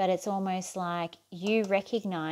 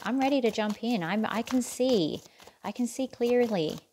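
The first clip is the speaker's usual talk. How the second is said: put-on higher, accidental American accent; bad American accent; 4 semitones higher than usual. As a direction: slip into a poor imitation of American. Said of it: "I don't know why I had an American accent then".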